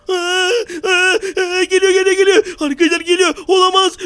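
being chased. Acted. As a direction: panic while running away. Fleeing the villain. (kovalanırken panik)

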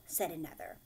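usual speaking voice

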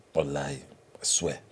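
whisper. Acted. Aside in a low voice.

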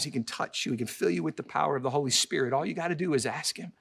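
ordinary talk

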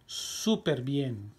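stretched out for learners; slow model reading. Shown as a demonstration. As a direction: say it slowly and clearly. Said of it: "teacher models the answer".

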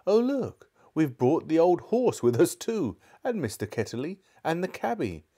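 high, startled exclamation; crying out. A narrator's reading. Girl discovering they brought horse, uncle and cabby, crying out. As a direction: burst out in high-pitched surprise. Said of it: "Polly cried out".

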